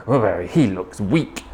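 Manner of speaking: low grumble of disapproval; grumbling. A narrator's reading. Mother T unimpressed by baby three, low grumble. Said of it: "and she grumbled".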